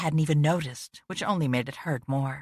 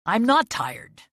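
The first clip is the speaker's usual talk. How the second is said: loud, higher angry snap; snapping; 6 semitones higher, 5 dB louder, slower than usual. A narrator's reading. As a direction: snap back, voice raised. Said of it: "he snapped, raising his voice".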